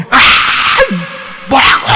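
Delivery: husband exploding, acted loud; loud angry outburst. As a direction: blow up at a nagging wife. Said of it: "큰 소리 했다 이거야". (버럭 소리치는 남편 흉내)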